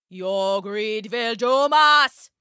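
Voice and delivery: slow, ominous villager with rising pitch; villager calling out. A narrator's reading. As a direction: draw it out as a warning, climbing steadily. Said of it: "started to call out".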